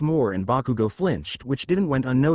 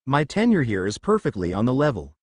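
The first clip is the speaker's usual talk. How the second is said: ordinary delivery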